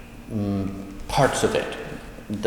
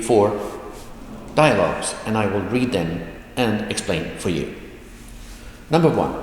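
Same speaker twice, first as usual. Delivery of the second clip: ordinary talk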